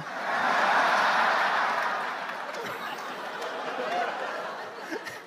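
a long airy high-pitched cackle; high breathy laugh. Real, not acted. Big laugh.

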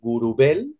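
Gurubel Pech said with native Spanish sounds; Spanish pronunciation of Gurubel Pech; Spanish accent. Shown as a demonstration. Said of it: "in a Spanish accent would be".

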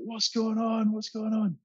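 bewildered plea. Retold or imitated. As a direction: call out, baffled, to the boat. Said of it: "I threw my hands in the air and I was like".

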